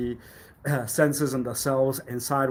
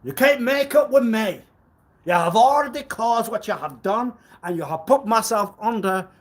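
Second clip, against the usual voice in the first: raised accusing voice; 8 semitones higher, 6 dB louder than usual. Acted. Sudden outburst at an imagined accuser.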